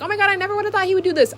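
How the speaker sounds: high, fast imitation of shocked fans; mock shock. Fans reacting to a future scandal, mock shock.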